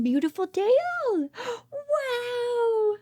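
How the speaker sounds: ordinary talk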